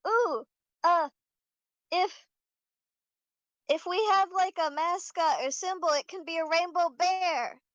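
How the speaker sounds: put-on high, bubbly little-girl voice; cartoon princess. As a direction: gush it like an excited small child. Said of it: "Comet's like".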